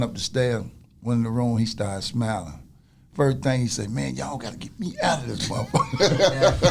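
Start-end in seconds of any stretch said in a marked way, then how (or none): 3.9-6.7 slow, chuckling through the line; laughing, half-amused quote. Retold or imitated. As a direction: retell it while laughing at the memory. Friend retelling Rob's first words.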